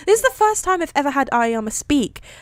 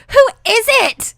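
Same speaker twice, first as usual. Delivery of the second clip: high, loud, demanding shriek; yell; 12 semitones higher, 7 dB louder than usual. A narrator's reading. Ashido demanding to know the spouse, high yell. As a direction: yell the question, pitch jumping up. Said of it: "she yelled".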